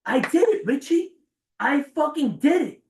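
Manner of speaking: slow exultant self-cheer; triumphant. Retold or imitated. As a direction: celebrate like you just won. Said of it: "I was like".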